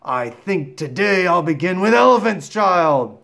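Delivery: loud, slow, rising crocodile menace; crocodile through teeth. Acted. Crocodile gripping the elephant child's nose, through clenched teeth. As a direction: force it out through gritted teeth. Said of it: "said it between his teeth like this".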